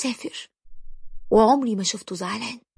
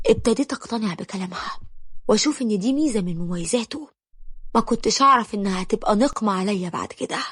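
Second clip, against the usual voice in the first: plain reading voice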